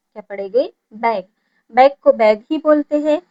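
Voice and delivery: ordinary talk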